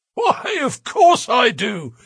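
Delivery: high, loud wheezy drawn-out voice; blustery high-pitched wheeze; wheezing. A narrator's reading. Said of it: "he wheezed".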